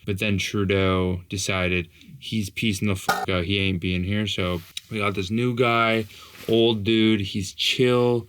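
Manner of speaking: usual speaking voice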